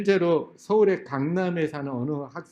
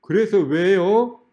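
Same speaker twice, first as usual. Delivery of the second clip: loud, drawn-out cheeky challenge; mock student's insolent comeback; defiant retort; 3 semitones higher, 5 dB louder, slower than usual. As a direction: stretch it out like a stubborn teenager. Student challenges the adult again.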